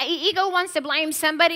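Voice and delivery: normal talking voice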